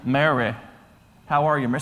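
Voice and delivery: put-on channel a deep, easy Southern charmer's accent; Matthew McConaughey. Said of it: "channeling my inner Matthew McConaughey".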